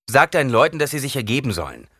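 high loud order, rushed end; commanding. Acted. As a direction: snap out the order to surrender. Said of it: "befahl Paul". (befehlend, laut und höher)

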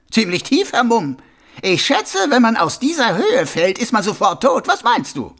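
high, loud mocking voice; a smug killer playing innocent; sneering villain. A narrator's reading. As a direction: Carcer mocking the watchman. Karzer mocks.

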